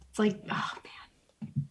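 trailing off into a whisper while searching for a name; breath-only murmur, words fading out; whispered to herself. Real, not acted. Host trying to recall a pen name, whispering to herself. Forgetting a name, muttering to self.

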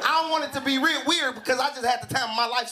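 ordinary delivery

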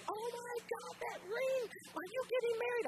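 high-pitched squealing excitement, imitated; ladies going crazy. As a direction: gush like a crowd of thrilled women friends. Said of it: "and the ladies went crazy".

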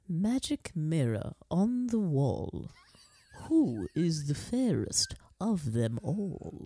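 slow, deliberate villainess impression; Evil Queen. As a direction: intone it slowly like a storybook villainess. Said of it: "Look at that little impression".

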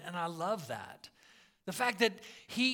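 ordinary talk, nothing marked

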